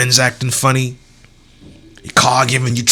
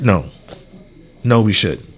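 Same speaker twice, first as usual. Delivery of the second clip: normal talking voice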